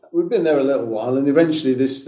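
ordinary talk, nothing marked

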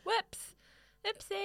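high, soft playing-dumb oopsie; feigned innocent oops; mock innocent. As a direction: act dumb and sweet. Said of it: "I'm just like".

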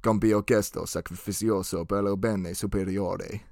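low, solemn Italian assassin's last rites; Ezio. Acted. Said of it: "my best Ezio here".

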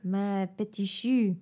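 slow mock French accent; lingering, jokey French endearment; funny French accent. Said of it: "start making funny French accents".

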